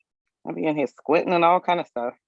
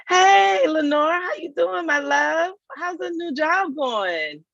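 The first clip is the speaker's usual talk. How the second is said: high, bright-toned shout of hello, then slowing down; calling out to a friend who just joined; excited high greeting; 11 semitones higher, much slower than usual. Real, not acted. Host greets Lenore joining the live session, excitedly.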